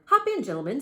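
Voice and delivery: cheerful cabbie; smiling. Acted. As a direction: say it with a big smile. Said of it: "with a big smile".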